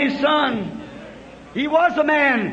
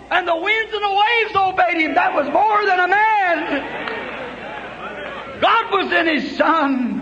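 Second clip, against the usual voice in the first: preaching at full force; 6 semitones higher than usual. Acted. Preacher telling the storm story to his church, full shout. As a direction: proclaim it over the crowd.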